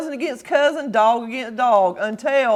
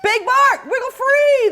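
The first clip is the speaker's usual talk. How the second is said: high, loud urging shout; high shouted call; 12 semitones higher, 4 dB louder than usual. Acted. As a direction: yell up to him to wriggle free. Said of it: "the prairie dogs shouted".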